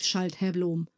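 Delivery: usual speaking voice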